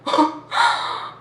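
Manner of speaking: acted-out big shaky breath; gasp, then breathe out hard; mock shocked intake of breath; startled gasp. Acts out her shocked breath.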